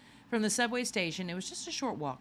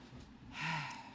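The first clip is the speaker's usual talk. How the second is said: weary sigh; 7 dB quieter than usual. Acted. A tired sigh before admitting he knows nothing. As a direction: let out a long tired sigh. Character sighs before answering.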